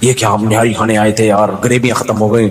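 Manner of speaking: low, groggy moan about the nihari running out; dazed overfed diner. Retold or imitated. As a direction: sound half-passed-out after overeating. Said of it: "थोड़ी बेहोश पड़े हुए हैं". (निहारी खाकर बेहोश सा, धीमी आवाज़ में)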